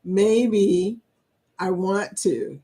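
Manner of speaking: ordinary talk, nothing marked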